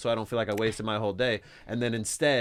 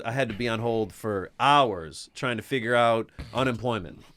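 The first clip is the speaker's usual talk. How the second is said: ordinary delivery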